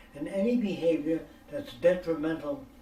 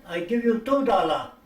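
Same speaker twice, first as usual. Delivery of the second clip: the foreigner's "I give you two dollar" called out louder, imitating broken English; loud mock haggling offer; 5 semitones higher, 9 dB louder than usual. Haggling counteroffer acted out.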